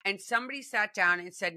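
normal talking voice

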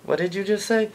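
put-on high, put-out girl voice; girlfriend's startled high question; offended girlfriend. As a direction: ask it like a shocked girlfriend. Girlfriend reacts to bad line.

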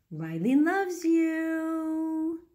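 high, very slow stretched coo. Real, not acted. Mother signing off to viewers on Riley's behalf, high slow coo. Sign-off in baby voice.

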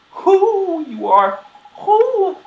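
high, drawn-out hooting voice; high owl-like granny; hooting owl lady. Acted. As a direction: stretch "who" into a loud owl hoot.

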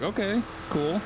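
bright, high, impressed okay cool; pleased by a first sighting. Retold or imitated. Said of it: "I was like".

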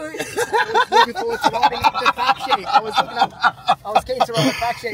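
laughing. Real, not acted. Cop laughing about the biker.

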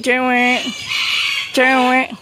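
very high, loud squawky call; parrot-prompting call. Pretend. Visitor coaxing a cockatoo to talk, high loud call.

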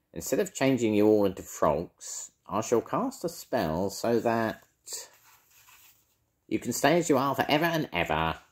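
wicked witch voice. Acted. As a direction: cast the spell with relish. Edna casting spell.

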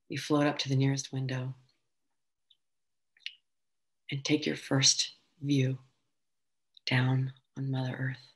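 calm, floating delivery with long pauses; guided meditation. Acted. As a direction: gently guide them through weightlessness, unhurried. Meditation settles into weightlessness.

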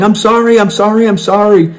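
high-pitched imitated yell; mock panicked yelling. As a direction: yell it high, like the man pleading in apology.

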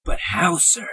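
breathy unvoiced whisper; hushed worried question. Underling asks doubtfully.

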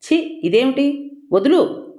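high, sharp disgusted outburst; a young woman shoving off an attacker, high and furious; angry. A narrator's reading. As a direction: push him off in disgust and anger. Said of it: "అంది కోపంగా".

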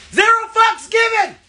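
high, loud and pumped up; hyped up. Real, not acted. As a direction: yell it out all fired up. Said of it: "Y'all ain't never seen me this hype".

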